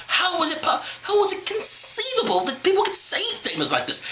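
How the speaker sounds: how can anyone say this; incredulous. Real, not acted. Incredulous outburst.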